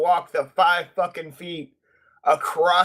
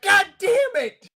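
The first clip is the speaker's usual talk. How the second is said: high, slow cry of frustration; exasperated shout; 14 semitones higher, 4 dB louder than usual. Retold or imitated. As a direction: wail it out to the sky. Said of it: "and I'm like".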